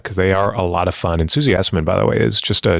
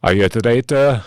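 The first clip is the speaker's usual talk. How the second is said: a bad, hammed-up Scottish accent; mock Scottish accent, trying too hard; terrible Scottish accent. Host retelling his try at Alan Cumming's line, bad Scottish accent. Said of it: "hear me do my terrible Scottish accent".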